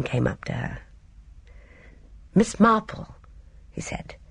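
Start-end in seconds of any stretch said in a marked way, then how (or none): 2.3-3.1 higher, lightly theatrical address; unexpectedly dramatic. A narrator's reading. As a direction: say the name like an amateur actor's first line. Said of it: "His voice had an unexpectedly dramatic quality".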